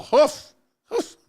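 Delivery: mock indignant huff, high and loud; huffy snort. Mimicking the councilwoman's offended huffing. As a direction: puff out an offended hoof sound. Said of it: "She got all huffy".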